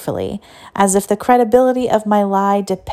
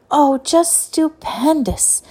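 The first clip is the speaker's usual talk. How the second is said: slow, high gushing delight; gushing praise; 8 semitones higher, slower than usual. A narrator's reading. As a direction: lay the praise on thick.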